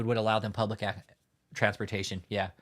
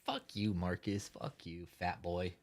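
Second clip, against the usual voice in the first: muttered insult; 7 semitones lower, 11 dB quieter, slower than usual. Retold or imitated. Mocking an auditor who stared him down, muttered low. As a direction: lower and much softer, a half-voiced insult. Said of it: "It's like".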